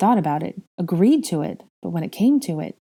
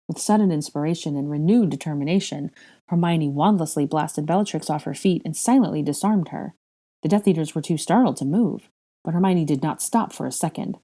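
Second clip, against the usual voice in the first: ordinary delivery, nothing marked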